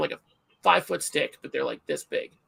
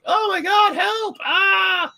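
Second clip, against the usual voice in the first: high, loud panicked yell for help; re-enacted shriek for help at the zoo; screaming for help; 6 dB louder, much slower than usual. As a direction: scream it at the top of your voice. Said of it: "like, top of my voice".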